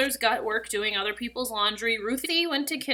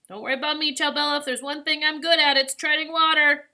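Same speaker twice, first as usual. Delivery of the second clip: higher, louder reassuring shout; raised voice from a distance; yelling back; 6 semitones higher, 7 dB louder than usual. A narrator's reading. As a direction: call back across the waves. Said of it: "Lito yelled back".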